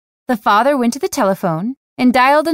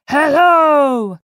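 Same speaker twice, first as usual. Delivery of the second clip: violent roar; 4 semitones higher than usual. A narrator's reading. Stranger roaring into the phone, furious. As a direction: bark the hello like a man at his limit. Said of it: "a violent voice roared".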